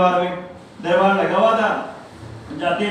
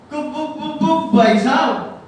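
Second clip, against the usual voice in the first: high stammering voice, growing louder; stuttering boy; 5 semitones higher, 3 dB louder, slower than usual. Retold or imitated. Boy explains how he sells the books, stammering ever louder. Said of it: "तुतला के बोलता है".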